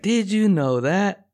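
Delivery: high, drawn-out TV science host question; Bill Nye. Retold or imitated. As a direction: slowly swoop up on a teasing quiz question. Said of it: "when he's like".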